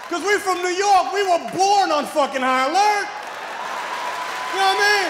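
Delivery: loud, high proud yell; shouted boast. Real, not acted. Comic boasting to New York crowd, shouted. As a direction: bellow it with hometown pride.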